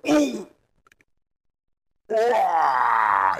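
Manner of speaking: high yelp into a shout; wounded ogre crying out after a gut slice; pained ogre cry. Shown as a demonstration. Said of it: "I'll just go".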